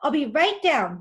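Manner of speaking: loud call from up the hill. Acted. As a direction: call out to someone below. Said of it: "yelled Marissa".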